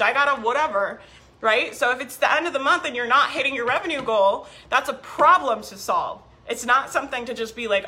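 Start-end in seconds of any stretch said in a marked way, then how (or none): none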